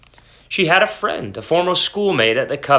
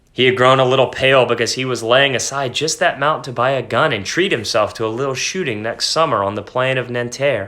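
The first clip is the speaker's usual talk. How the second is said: usual speaking voice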